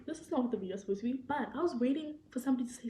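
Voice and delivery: ordinary talk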